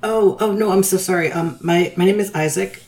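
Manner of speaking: flustered apology. Acted. Nervous student apologizes to the old woman, flustered. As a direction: nervously correct a misunderstanding.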